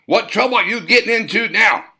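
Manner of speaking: high, loud scolding call; café owner scolding shout. Acted. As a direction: yell at him across the lot. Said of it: "She shouted".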